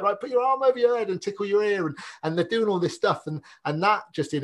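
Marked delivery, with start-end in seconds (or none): 0.0-1.9 high, bright instruction voice; playful instructor. Retold or imitated. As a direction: lead them like a kids' class. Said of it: "I'm like".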